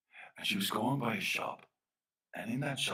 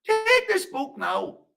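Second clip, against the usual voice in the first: high, loud, drawn-out order; commanding fairy man; 12 dB louder, much slower than usual. Acted. Fairy man hands over the book, commanding loudly. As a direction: stretch the command out in a high shout.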